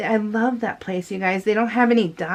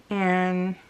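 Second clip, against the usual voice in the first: ordinary talk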